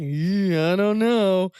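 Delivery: drawn-out, hesitant imitation; imitating someone unsure and dubious; mock doubtful. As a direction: stretch the words out with exaggerated doubt. Imitating doubtful remark before surgery.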